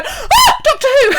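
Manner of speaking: very high, loud squeal; mock fan excitement. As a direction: imitate thrilled English tourists, laughing. Mimics tourists' excited cry.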